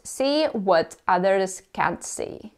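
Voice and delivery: normal talking voice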